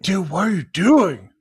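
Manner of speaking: high, loud, slow mock yell of a gas attendant; mock shout. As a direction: play an alarmed attendant yelling at a driver. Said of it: "they will shout at you".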